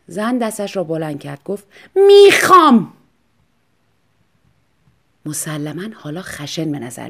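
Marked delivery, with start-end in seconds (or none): 2.0-2.9 high, loud, falling demand; fierce demand. Acted. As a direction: insist like a spoiled queen. Wife raises hand, demands. (خواستنِ بلند و تند)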